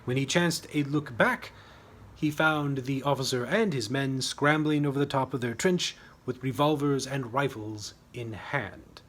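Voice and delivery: usual speaking voice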